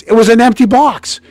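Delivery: high, loud indignant telling; outraged disbelief. Real, not acted. Telling friend about the empty Junior Mints box, outraged. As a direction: rise up in mock outrage.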